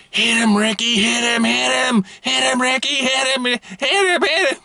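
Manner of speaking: high, loud imitated scream egging on her son; screaming mom. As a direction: shriek it at the top of your voice like a furious mom. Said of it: "I hear her screaming".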